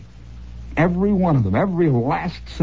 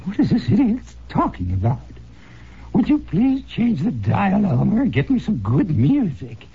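put-on little old lady; 5 semitones higher than usual. Old lady telling her husband Elmer to change the station, higher and complaining. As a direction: grumble like an old Staten Island lady at the radio. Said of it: "this little old lady out in Staten Island".

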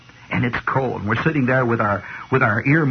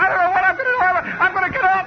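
high, frantic answer; hammed-up fright, much higher; mock panic; faster than usual. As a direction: scramble for a way out. Panic on runaway boat.

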